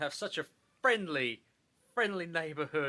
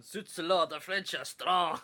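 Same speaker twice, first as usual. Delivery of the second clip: put-on French accent. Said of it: "we've gotta do French accents".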